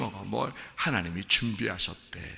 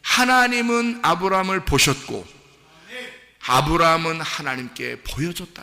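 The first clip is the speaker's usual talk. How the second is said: loud, raised declaration; full-force preaching; 5 semitones higher, 8 dB louder than usual. Real, not acted. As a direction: proclaim it to the whole congregation.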